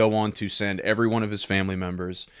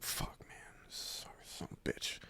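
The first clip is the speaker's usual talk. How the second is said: hushed, despairing curse under the breath; sulking mutter; 20 dB quieter than usual. Retold or imitated. Customer sulking outside the dealership, muttering curses under his breath. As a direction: mutter it to yourself like a man who's given up. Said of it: "he's sitting out front sulking".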